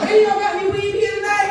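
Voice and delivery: loud, high, laughing shout; laughing call. Real, not acted. Comedian hollering at the audience for weed, loud laughing call. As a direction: holler it at the crowd.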